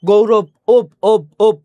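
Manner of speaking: ওপ said loud with a clear rounded ও at the front; ওপ sound shown. Shown as a demonstration. Teacher drilling students on the ওপ sound, loud and clear. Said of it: "এই যে ও এর মতো উচ্চারিত হচ্ছে".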